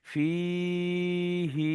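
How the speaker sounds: ফিহি drawn out softly; slow recitation. Shown as a demonstration. Teacher finishing the line for learners, soft slow chant. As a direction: hold the হি long and soft. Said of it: "এই তিন লাইনটা একটু ঝাট করে দেখে নেন".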